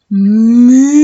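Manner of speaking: loud long moo, lips together; sustained mooing for children; cow moo. Shown as a demonstration. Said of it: "So lips together".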